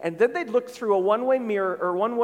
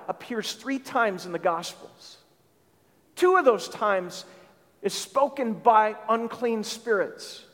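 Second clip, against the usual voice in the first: ordinary talk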